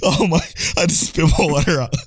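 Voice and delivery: ordinary talk, nothing marked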